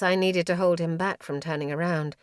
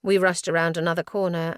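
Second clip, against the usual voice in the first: usual speaking voice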